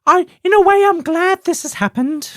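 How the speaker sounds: high, put-on British falsetto; fluty British TV cook putting a good face on it; Julia Child parody. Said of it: "I don't know why I'm British".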